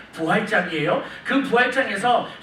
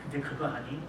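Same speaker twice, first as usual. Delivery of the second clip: softer, intimate voice of God; God's voice; 8 semitones lower, 16 dB quieter than usual. Retold or imitated. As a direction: lower and hushed, like God confiding to his son. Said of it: "하나님이 뭐라 그러신가 하면". (아들에게 속삭이듯 말하는 하나님, 부드럽고 조용하게)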